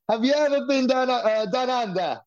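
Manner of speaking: high, put-on Australian accent; high mock Aussie accent. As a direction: imitate an Australian asking a question. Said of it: "like, in an Australian accent".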